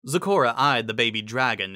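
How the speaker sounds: plain reading voice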